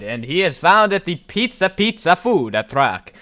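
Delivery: playful put-on Italian accent, lilting pitch; hammed-up Italian accent on the food truck's name. Narrator naming a food truck to viewers, mock Italian accent. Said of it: "I said that in an Italian accent".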